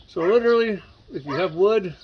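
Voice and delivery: talking around screws held in the lips; screws in mouth. Real, not acted. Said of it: "So I'm gonna talk a little funny for a little bit here".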